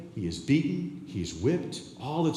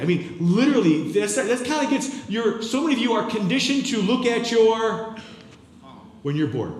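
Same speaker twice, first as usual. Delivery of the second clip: preaching at full force; 10 semitones higher, 7 dB louder than usual. Real, not acted.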